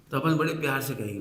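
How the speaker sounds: ordinary talk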